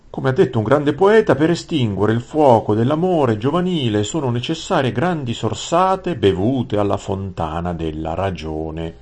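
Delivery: high, loud sententious courtier voice; pompous vizier. A narrator's reading. Grand vizier lectures the prince on love, pompous. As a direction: declaim the proverb like a fawning old vizier.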